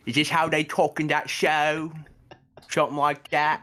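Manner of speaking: put-on testing a rough Birmingham accent; Peaky Blinders accent. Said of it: "with my, uh, Peaky Blinders accent".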